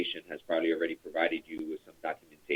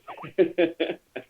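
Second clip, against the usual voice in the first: laughing at himself; 11 semitones higher than usual. Real, not acted. Laughs after pilot bit.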